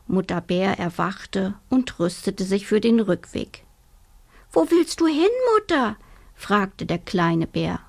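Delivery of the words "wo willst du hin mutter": high, startled cub question; surprised little bear. Acted. Little bear asks his mother where she goes, high and startled. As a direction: ask it high and worried.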